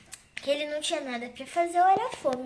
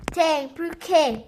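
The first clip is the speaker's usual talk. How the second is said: annoyed attendant; 2 semitones higher, 9 dB louder, much slower than usual. Acted. Annoyed flight attendant answers passenger.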